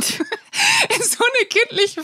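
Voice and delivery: slow, laughing through words. Real, not acted. Laughing at own reaction.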